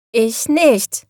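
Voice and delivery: mild protesting call. A narrator's reading. Little witch answers the scolding raven, calling out. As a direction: call it back in light protest. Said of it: "rief die kleine Hexe".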